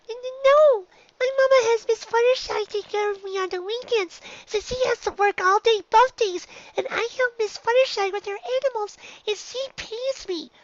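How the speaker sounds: a frightened filly rushing to explain; flustered Dinky. Acted. Dinky explains to the bullies where the money came from, high and stammering.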